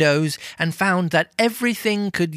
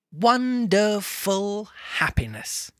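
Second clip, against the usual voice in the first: slow, higher, stretched-out delight; savoured climax; 5 semitones higher, slower than usual. A narrator's reading. Narrator caps the list of Christmas joys, savouring it. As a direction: draw out each syllable of wonderful.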